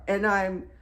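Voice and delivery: usual speaking voice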